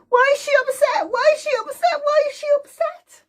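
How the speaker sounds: put-on very high puzzled baby voice; be the confused little baby; very high, bewildered baby asking; baby's voice. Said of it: "Babies goes".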